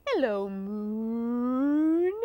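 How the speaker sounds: very slow, cow-like greeting; long moo gliding upward. Acted. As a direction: stretch "moon" into a long moo.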